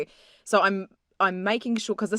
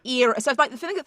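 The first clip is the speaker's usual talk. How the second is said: ordinary delivery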